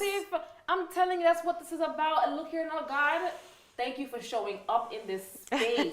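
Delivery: high, rising call of praise; excited praise. Real, not acted. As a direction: lift it up like a testimony. Host moved, praising guest.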